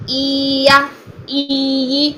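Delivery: ای یا drawn into a long madd; wrong long vowel. Shown as a demonstration. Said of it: "جو کہ غلط ہو جائے گا".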